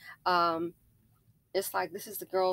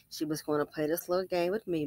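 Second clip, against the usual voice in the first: normal talking voice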